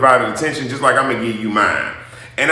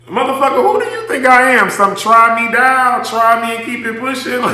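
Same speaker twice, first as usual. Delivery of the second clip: offended outburst; 9 semitones higher, 3 dB louder than usual. Real, not acted.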